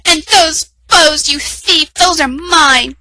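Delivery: high, loud, slow scolding; accusing stepsister. A narrator's reading. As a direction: spit out you thief. Stepsisters tearing dress, accusing.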